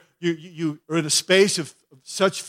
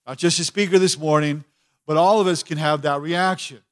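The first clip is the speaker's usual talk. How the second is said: ordinary talk, nothing marked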